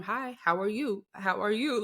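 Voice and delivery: lightly imitated high, chirpy hello; bubbly child greeting. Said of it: "Celine's so bubbly and, like, a people person".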